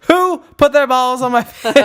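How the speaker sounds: high, loud mock British demand; terrible British accent. British pledge demanding the culprit, high loud British accent. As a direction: wail it in a badly faked British accent. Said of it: "This is my terrible British accent".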